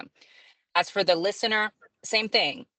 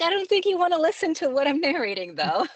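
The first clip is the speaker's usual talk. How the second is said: much higher, teasing reluctance; playful self-deprecation; 11 semitones higher, 3 dB louder than usual. Retold or imitated. Narrator warning friends off her spicy books, much higher and teasing. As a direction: talk the listener out of it with a grin. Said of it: "It's like".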